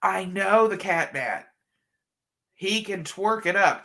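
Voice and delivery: usual speaking voice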